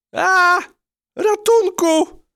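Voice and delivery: very high, loud, drawn-out cry; a trapped mouse screeching for help; scream for help. Acted. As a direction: shriek in sudden panic. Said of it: "wrzasnęła".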